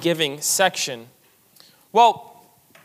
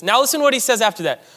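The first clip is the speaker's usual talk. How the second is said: ordinary delivery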